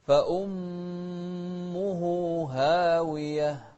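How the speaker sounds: فأمه very slow, sharp hamza. Shown as a demonstration. Teacher chants فأمه slowly for the student. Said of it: "وضحنا الهاء بعدها هاء أخرى".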